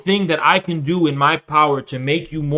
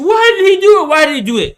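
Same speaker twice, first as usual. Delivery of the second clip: mock crying mom; 13 semitones higher, 6 dB louder, faster than usual. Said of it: "she's gonna ask you".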